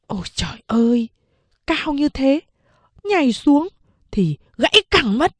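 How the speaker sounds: ordinary talk, nothing marked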